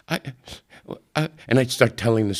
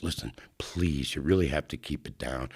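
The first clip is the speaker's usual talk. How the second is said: 1 hushed plea; 4 semitones lower, 6 dB quieter than usual. Retold or imitated. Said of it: "and he goes".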